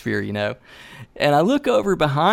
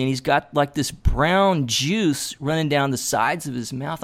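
usual speaking voice

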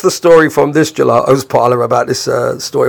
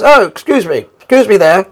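calling up to a window, raised pitch; retold shouting up; 5 semitones higher than usual. Couple in the street calling up to the band at the window, retold shout. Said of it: "they shout up and says".

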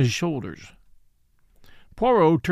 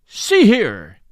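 loud, higher-pitched outburst; angry cry; 5 semitones higher, 8 dB louder than usual. A narrator's reading. Millionaire banging the table at the police, angry cry. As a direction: burst out, demanding attention. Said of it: "he cried".

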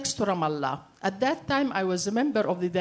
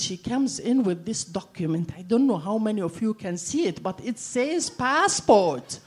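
usual speaking voice

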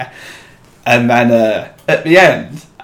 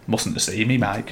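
understated retold aside; 9 dB quieter than usual. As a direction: quietly quote a resigned remark. Retold muttered aside to his mate.

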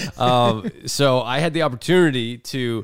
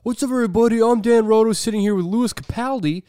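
loud, high, bright announcer hype; radio host intro; 9 semitones higher, 4 dB louder than usual. Retold or imitated. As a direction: pump it up like a DJ opening the show. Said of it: "I'm like".

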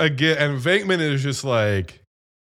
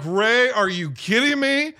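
higher, incredulous plea; mock exasperation; 7 semitones higher than usual. Winston to Ray when he hesitates, exasperated. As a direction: act out exasperated disbelief.

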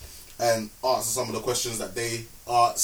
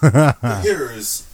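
dramatic announcer; 10 dB louder, much slower than usual. Acted. Podcast intro announcer voice.

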